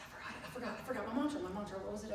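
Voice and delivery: normal talking voice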